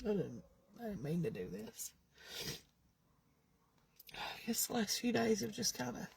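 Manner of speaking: low, quiet, breaking voice; crying. Real, not acted. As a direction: apologize through tears. Breaks down apologizing.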